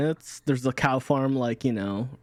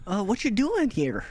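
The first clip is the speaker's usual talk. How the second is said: put-on Wisconsin accent; 5 semitones higher, slower than usual. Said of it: "It's just me and my Wisconsin accent".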